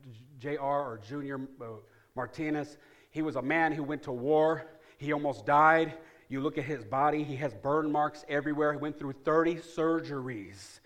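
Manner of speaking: ordinary delivery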